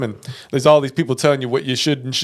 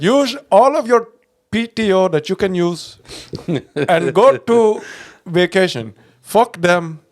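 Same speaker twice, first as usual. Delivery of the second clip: higher, slow mock Indian accent; 5 semitones higher, slower than usual. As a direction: imitate the Indian guy from the reel, stretched and high. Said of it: "that guy in that Indian accent".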